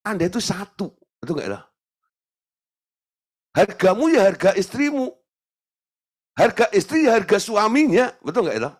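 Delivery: usual speaking voice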